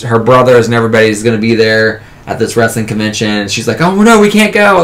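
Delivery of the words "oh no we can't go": high, panicky mock alarm. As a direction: squeak it like sudden dread. Panicked wrestler's family coming.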